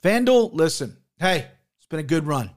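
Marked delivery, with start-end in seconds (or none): none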